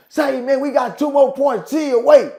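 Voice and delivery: retold shout across the court; calling back. Retold player calling back to guard.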